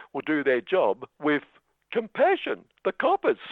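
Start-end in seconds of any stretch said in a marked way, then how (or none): 1.9-2.6 compassion pitched far up in mock disbelief; incredulous high. Shown as a demonstration. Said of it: "Let's say that again". 2.8-3.4 incredulous. Acted. Mocking disbelief at police claim.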